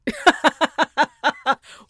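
a loud, high cackle at her own joke; loud laugh. Real, not acted. Host jokes about champagne for a friend's mani pedi, loud cackle.

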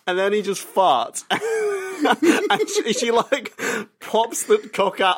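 high, giggly telling through laughter; real laughter pushing the voice up; laughing. Real, not acted. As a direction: keep telling it while cracking up. Cracking up telling the story.

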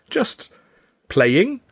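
hesitant, high tentative answer; pause, then offer a feeble excuse; sheepish. A narrator's reading. Father excusing himself to mother, sheepish. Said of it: "I said, sheepishly".

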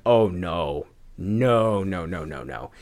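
low, soft, sinking string of no's; retold dismay. Retells dismay at rewatching episode.

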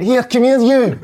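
high, loud imitated challenge; hammed-up calling out; mock confrontational shout. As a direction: call him over loudly, spoiling for it. Acted player calls opponent over.

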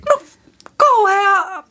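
mock dismay.

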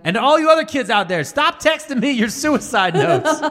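speakerphone rant. Retold or imitated. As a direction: holler it at the whole school. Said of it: "this is my speakerphone voice".

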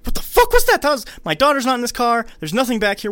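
very high, loud panicked shriek; hammed-up terror in the car; mock freaked-out scream. Annie hearing a noise while driving, mock screaming. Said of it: "And she, like".

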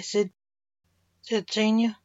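usual speaking voice

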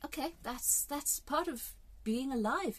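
normal talking voice

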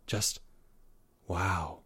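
low, very soft wonder; awestruck. A narrator's reading. Lovestruck boy to his friend, hushed awe. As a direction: let it out as a dazed hush. Leo stunned by love.

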